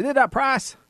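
high-pitched imitation of a Midwest dealer's accent; car dealer's accent. Friend quoting famous car dealer, imitated accent. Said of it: "he did a great accent".

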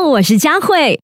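ordinary delivery, nothing marked

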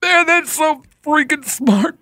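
high, drawn-out mock amazement; slow, over-the-top fake wonder; sarcastic awe. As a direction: gush as if floored by genius. Mock awe at played clip.

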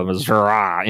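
Missouri said Miz-ur-ah with a broad ah ending; Missoura pronunciation. Shown as a demonstration. Host mocking candidates' rural Missouri, said as Missoura. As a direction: drawl out the ah at the end of Missouri. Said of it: "They get a Southern draw".

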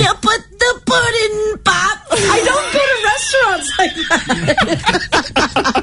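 high strained slow falsetto; constipated Bill Cosby. Retold or imitated. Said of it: "constipated Bill Cosby".

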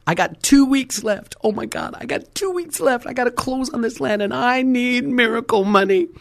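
high-pitched, frantic retold alarm; mock desperate plea; acted panic. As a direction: wail it as the deadline closes in. Acted panic over closing deadline.

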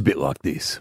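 sexy deep voice. Pretend. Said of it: "Give us the voice again".

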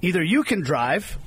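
usual speaking voice

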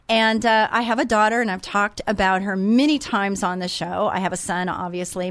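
normal talking voice

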